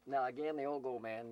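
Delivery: normal talking voice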